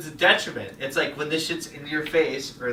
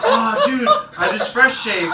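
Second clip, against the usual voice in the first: high, laughing mock-casual friend voice; mimicking friend; 9 semitones higher, 5 dB louder than usual. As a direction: play the clueless friend, giggling. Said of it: "He's like".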